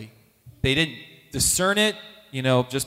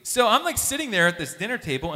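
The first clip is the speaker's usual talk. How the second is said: ordinary delivery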